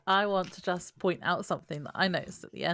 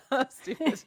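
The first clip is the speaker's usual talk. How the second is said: cracking up; 10 semitones higher than usual. Real, not acted. Laughing at her own line, giggly blurt.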